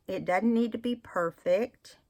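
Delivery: usual speaking voice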